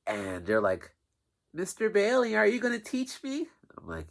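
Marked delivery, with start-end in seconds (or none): 1.5-3.5 put-on much higher kid voice; a squeaky eager child; imitating a kid. Kids asking eagerly.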